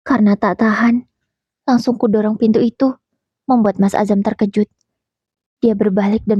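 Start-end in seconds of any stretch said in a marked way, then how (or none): none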